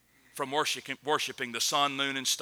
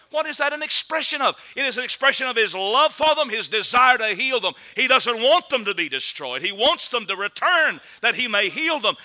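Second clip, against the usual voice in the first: raised, impassioned preaching; 5 semitones higher, 4 dB louder than usual. Acted. Preacher telling the church about God's love, impassioned preaching. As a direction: lift the voice to proclaim God's love.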